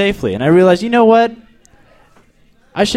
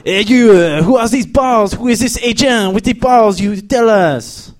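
higher-pitched, hammed-up French accent; French terrorist accent; 5 semitones higher than usual. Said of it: "That's a French terrorist".

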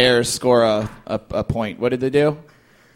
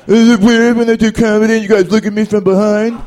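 mocking imitation; 7 semitones higher, 6 dB louder than usual. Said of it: "do they sound like that too".

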